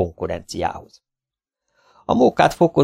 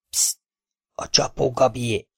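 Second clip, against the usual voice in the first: hushed, softer, like a state secret; secretive whisper; 2 semitones higher, 6 dB quieter than usual. Acted. Father confides to the buyer, hushed and secretive. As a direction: lean in and confide it. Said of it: "úgy súgta a fülébe".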